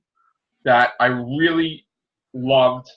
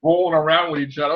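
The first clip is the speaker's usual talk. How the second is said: ordinary delivery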